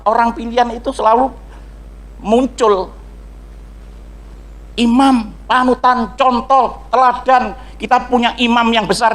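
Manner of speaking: high, insistent sermon delivery; preaching at full force. Real, not acted. Preacher exhorts congregation about being chosen people, preaching at full force. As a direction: preach it high, drawing out key words.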